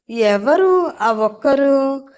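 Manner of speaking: slow, suspicious question; husband suspiciously asking who the other one is. A narrator's reading. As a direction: draw it out with narrowed suspicion. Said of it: "అనుమానంగా చూస్తూ అడిగాడు".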